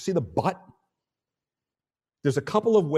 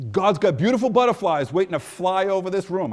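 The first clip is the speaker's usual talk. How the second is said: rising, louder and bold; grand flourish; 6 semitones higher, 6 dB louder than usual. Real, not acted. Pastor tells the room butterflies await, with a big flourish. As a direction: sell the grand image fully. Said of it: "I can't believe I just said that".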